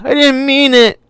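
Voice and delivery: put-on girl wailing sorry to Doctor Grant. A narrator's reading. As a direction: wail the apology like a frightened child. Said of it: "Lex wailed".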